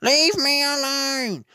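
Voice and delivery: high, slow, far-off shout; high pleading yell. Acted. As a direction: yell it at them to back off. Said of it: "he yelled".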